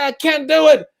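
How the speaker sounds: anxious guard. Acted. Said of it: "He said".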